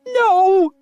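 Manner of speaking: very high, loud squeal; a high, loud desperate squeal. A narrator's reading. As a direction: refuse in terror. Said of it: "she squealed".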